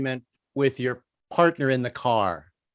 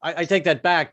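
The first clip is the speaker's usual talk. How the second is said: usual speaking voice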